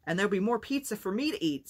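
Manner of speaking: put-on little boy cheering about extra pizza; excited cry. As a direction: cheer it out while clapping. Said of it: "Sammy cried, clapping his hands".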